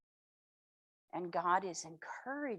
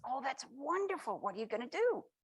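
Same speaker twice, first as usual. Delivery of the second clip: higher, eager delight; excited; 6 semitones higher, 4 dB louder than usual. Acted. First little soul eager to hear the plan, excited. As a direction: lean in, eager to hear more. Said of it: "the little soul gets excited and says".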